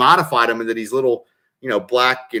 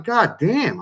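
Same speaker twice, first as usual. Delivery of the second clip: mock awe; 9 semitones higher than usual. Retold awe at the fries.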